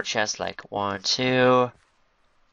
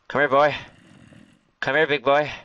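higher, raised call to a pet; calling an animal; 4 semitones higher, 4 dB louder than usual. Real, not acted. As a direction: coax the animal over, pitched up. Calling an animal over in game.

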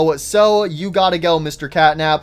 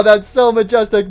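usual speaking voice

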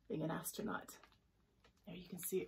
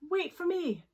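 high, raised call; 10 semitones higher, 5 dB louder than usual. Acted. Mermaid calls to be let aboard, calling out. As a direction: call after a friend. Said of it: "called out a voice".

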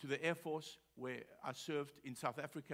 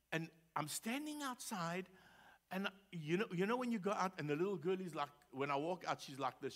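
ordinary talk

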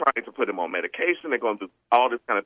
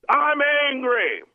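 put-on high, slow, sulky child voice; imitating an angry boy; 12 semitones higher, slower than usual. Second-grade boy telling the principal he is angry, sulky. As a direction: drag it out like a pouting second-grader. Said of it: "He stands up".

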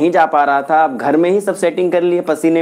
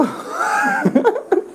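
high, bursting laugh at himself; 4 dB quieter than usual. Real, not acted. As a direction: crack up at your own slip. Forgot to speak English.

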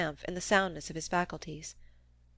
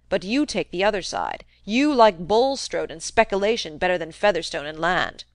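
put-on loud, scornful old farmer railing at religion; cranky old man ranting; 2 semitones higher, 6 dB louder than usual. Old Featherstone scorns Bulstrode to nephew Fred, loud rant. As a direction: pound the table about land and God.

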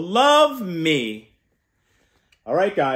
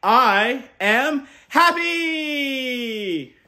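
very slow, loud, much higher held cheer; 8 semitones higher, 3 dB louder, much slower than usual. Shown as a demonstration. Uncle modelling a cheer for kids, very slow. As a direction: cheer it out long and bright. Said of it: "jump out of bed and cheer".

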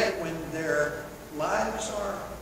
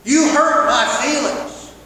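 loud, higher, slow blame on You; accusing complaint; 8 semitones higher, 15 dB louder, slower than usual. Shown as a demonstration. Preacher mocking a guilt-tripping spouse, loud blame. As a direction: throw the blame at them. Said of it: "that's emotional manipulation".

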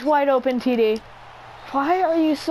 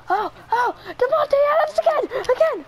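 fast, high-pitched hype; real rapid burst of celebration; excited shout; 11 semitones higher than usual. Real, not acted. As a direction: squeal the player's name in triumph. Calling out a repeat catch.

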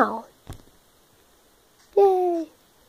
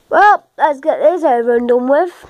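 ordinary delivery

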